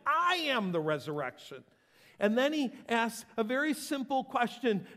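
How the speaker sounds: loud, high proclamation; full-force preaching. Real, not acted. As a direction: thunder it to the church. Preaching climax.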